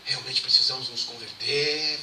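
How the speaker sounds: slow, a hint of a solemn nun; a touch of the nun's measured, slow thanks; lightly voiced nun. Nun thanking the reverend, slow and solemn. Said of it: "ela olhou pra mim assim, falou".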